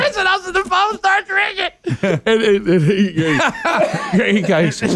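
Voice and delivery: high, laughing through each word; fighting off giggles to keep going. Real, not acted. Retelling the phone call to friends, laughing.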